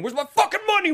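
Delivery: high mock yell at Denny; retold yell. Podcaster imitating a thug yelling at Denny for money. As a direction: shout it like a thug wanting cash. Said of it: "just starts yelling".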